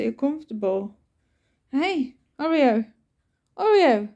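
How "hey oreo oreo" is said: high, louder call of Oreo; calling the cat. Real, not acted. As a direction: call out to get the cat's attention. Calling her cat by name.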